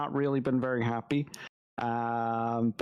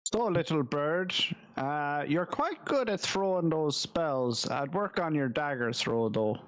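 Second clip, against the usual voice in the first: lightly voiced gruff mentor; a hint of a teasing old mentor; character voice. Player voices Grandolph in character.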